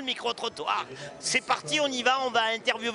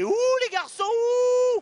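loud, high playful boo; playful booing of the boys' team; mock booing; 5 dB louder, slower than usual. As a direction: boo the boys, hamming it up.

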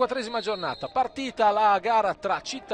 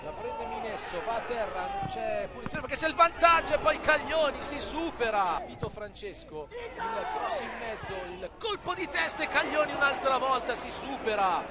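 quick, rising live match call; breathless italian goal commentary; 6 semitones higher than usual. Real, not acted. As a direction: ride the action breathlessly, building to the goal.

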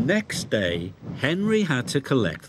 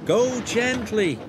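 slow, higher calling out; driver calling; 10 semitones higher, slower than usual. A narrator's reading. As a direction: call gently to the engine. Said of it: "called his driver".

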